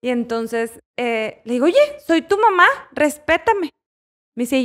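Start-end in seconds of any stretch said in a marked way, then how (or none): 1.7-3.7 high, sharp mock scolding; mother scolding son. Mother scolding her driving son, mildly mock angry. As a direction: snap at him like an offended mother. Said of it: "con su voz fuerte".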